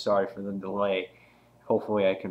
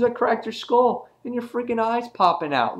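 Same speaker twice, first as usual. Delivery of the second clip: high, louder retold gloating; acted villain taunt; 13 semitones higher, 5 dB louder than usual. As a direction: lightly voice a villain, pitched well up. Acting out villain gloating over victim.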